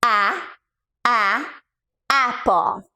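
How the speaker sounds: A, a, apple with the short a of apple; short a sound. Shown as a demonstration. Coach sounding out apple for kids. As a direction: repeat A, a before apple. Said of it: "Or you will do ah".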